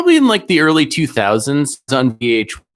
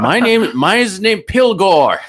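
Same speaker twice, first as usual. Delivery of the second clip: mock goat owner; 9 semitones higher, 4 dB louder than usual. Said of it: "He names his".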